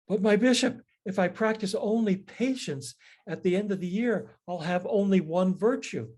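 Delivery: worked up. Retold or imitated. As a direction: play the priest getting all worked up. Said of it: "the priest protests".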